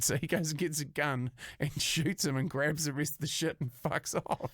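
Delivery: ordinary talk, nothing marked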